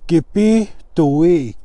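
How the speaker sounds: "kipituik" said slowly with a drawn-out ee; long E demonstrated. Shown as a demonstration. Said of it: "E sound".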